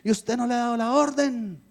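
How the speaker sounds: angry complaint. Retold or imitated. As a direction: demand it like an entitled caller.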